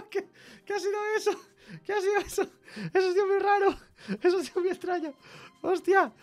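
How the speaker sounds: high, giggly disbelief; laughing. Real, not acted. Coder laughs at the weird robot voice, high and giggling. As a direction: laugh it out, spooked and amused. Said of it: "que me parto".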